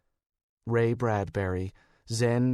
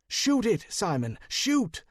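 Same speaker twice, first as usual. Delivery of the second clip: urgent shout; 9 semitones higher than usual. Acted. Urging shot at charging werewolf.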